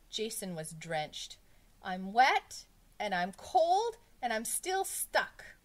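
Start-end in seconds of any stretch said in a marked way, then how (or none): 1.8-5.5 loud, high, drawn-out grievance; slow schoolboy wail; miserable complaint. A narrator's reading. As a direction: list your woes indignantly. Drenched pupil complains.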